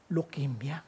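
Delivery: hushed, breathy grave word; whispered reveal. Acted. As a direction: whisper it like bad news. (pabulong na malungkot)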